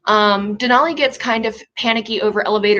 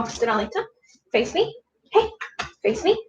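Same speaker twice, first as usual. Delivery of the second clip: dog command voice; 8 semitones higher, 5 dB quieter than usual. Real, not acted. Commanding dog during training.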